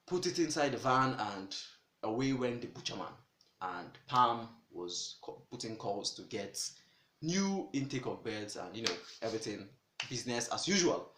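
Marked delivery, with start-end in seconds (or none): none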